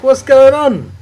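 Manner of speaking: high, loud disbelieving cry; a loud, high "what's going on"; baffled outburst. Retold or imitated. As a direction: shout it in total confusion. Baffled reaction to the news.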